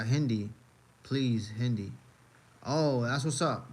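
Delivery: usual speaking voice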